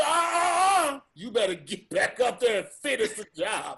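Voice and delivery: loud barking order, half laughing; mock shouting boss. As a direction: yell it like a strict boss, laughing. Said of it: "You thought the comedy post gonna be".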